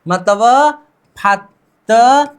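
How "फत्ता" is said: Fatta stretched out slowly with a rising end; slow demo. Shown as a demonstration. Teacher slowly modelling the merged sound for students. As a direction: draw out Fatta slowly, as if asking. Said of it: "द और द फिर से मिल गए".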